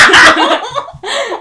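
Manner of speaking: high giggling fit; burst of laughter. Real, not acted. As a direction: laugh out loud, real. Laughing at name confusion.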